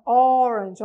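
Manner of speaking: orange with a tight closed O, said loud. Shown as a demonstration. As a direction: push a closed O in orange, loudly. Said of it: "olha, som O, bem fechadinho". (orange com O bem fechadinho, mais alto)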